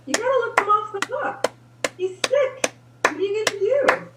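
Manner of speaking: high, loud, fast insistence; emphatic. Real, not acted. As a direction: press the point with energy. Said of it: "emphatic advice, high and loud".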